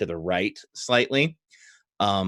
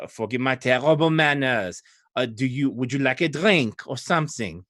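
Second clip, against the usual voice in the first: put-on polite French-accented hostess; Old Adari accent, French-like. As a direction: offer hospitality in a French accent. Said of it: "Her accent would indicate".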